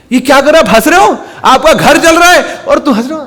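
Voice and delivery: high, loud, incredulous outburst; shocked scolding. Acted. Second son finds his father laughing, shocked. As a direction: confront him in disbelief.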